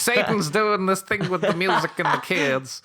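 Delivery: high, slow, mocking church-lady voice; preachy moral panic voice. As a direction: ham up a scandalised conservative. Mocking conservatives blaming Satan.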